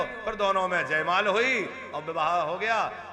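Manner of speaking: ordinary talk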